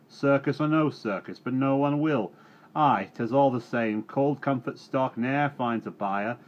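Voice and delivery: loud, high rustic grumble; grumbling farmer. A narrator's reading. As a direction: complain bitterly about the farm. Farmer complains and demands.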